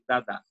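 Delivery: "দাদা" short and low, no stretch; quick short vowels. Shown as a demonstration. Teacher showing the correct pronunciation, quick short vowels. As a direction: model "দাদা" as one quick word. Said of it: "যথার্থ উচ্চারণটা চাচ্ছি".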